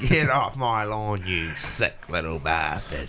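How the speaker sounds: put-on slow, cranky old-timer yelling off kids; grumpy old man. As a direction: drag it out like a fed-up old man. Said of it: "someone who's complaining about".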